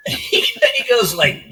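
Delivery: high, drawn-out monster laugh; Bowser laugh. Retold or imitated. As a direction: cackle like a happy Bowser. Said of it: "What does Bowser say when he's, like, really happy?".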